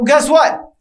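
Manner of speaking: ordinary talk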